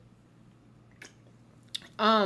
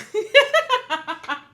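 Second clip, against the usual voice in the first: sudden burst of high laughter; loud laugh; 14 dB louder than usual. Real, not acted.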